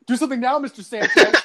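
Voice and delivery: high, loud laughing challenge; pretend taunting. Former pupil daring old teacher in store, playfully taunting. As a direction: dare him, breaking into laughter.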